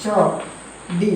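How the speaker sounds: ചൊടി stretched very slowly; slow drawn-out demo. Shown as a demonstration. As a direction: sound out ചൊടി syllable by syllable. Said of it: "പൊടി". (ചൊടി പതുക്കെ നീട്ടി)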